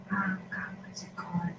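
very soft, near-whispered aside; muttering to herself. Real, not acted. Crafter trying to recall a material's name, muttering. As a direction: murmur it under your breath, searching.

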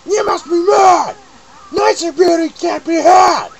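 very high, loud, slow witch scream; furious witch shout. Acted. Jealous witch refusing to believe Tooty is prettier, screaming.

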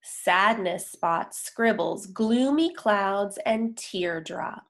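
usual speaking voice

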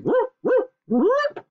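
high, loud woofs; high-pitched yapping woofs; dog barking. A narrator's reading. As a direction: bark like a confused dog. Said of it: "Randolph barking".

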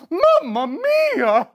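slow, hammed-up Italian accent. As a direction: exclaim like a stage Italian. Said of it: "I am speaking fluent Italiano!".

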